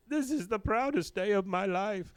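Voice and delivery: high-pitched mock excitement; acted super-excited outburst; Khan. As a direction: exclaim it in an overjoyed put-on voice. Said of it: "is super excited".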